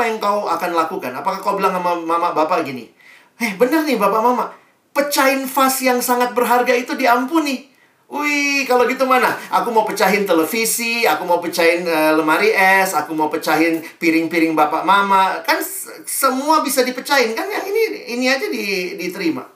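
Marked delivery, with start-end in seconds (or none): 3.4-15.4 higher, louder cocky glee; gleefully plan more smashing, voice much higher; child to parents, cocky and gleeful; cheeky child. Retold or imitated. Child cheekily exploiting forgiveness. 15.4-19.5 put-on cheeky child. Child continuing the cheeky plan.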